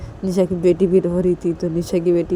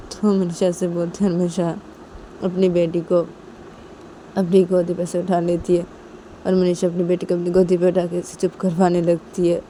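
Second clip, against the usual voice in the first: ordinary delivery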